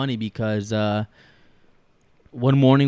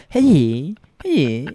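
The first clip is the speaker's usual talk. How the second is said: a higher, louder goofy Sandler-style "Hey"; Adam Sandler impression; 5 semitones higher, 5 dB louder than usual. Explaining a failed Italian accent with a Sandler-style call. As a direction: blurt it out like a goofy Sandler character. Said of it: "Adam Sandler".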